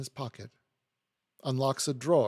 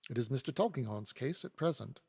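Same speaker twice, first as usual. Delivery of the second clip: ordinary delivery, nothing marked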